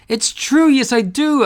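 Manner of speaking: very high, excited little cork; eager squeak. Acted. Cork eagerly answers the whale, high and excited. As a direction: pipe up thrilled.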